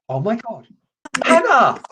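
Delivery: high, loud call of a name; happy hello shout; excited greeting. Real, not acted. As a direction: call her name in delight. Greeting caller as she appears.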